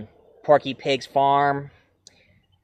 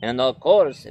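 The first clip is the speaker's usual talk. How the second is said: ordinary talk, nothing marked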